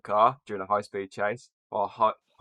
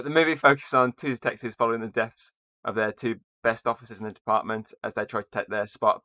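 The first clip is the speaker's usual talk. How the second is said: ordinary talk, nothing marked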